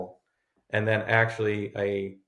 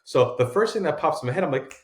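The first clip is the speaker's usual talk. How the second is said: ordinary delivery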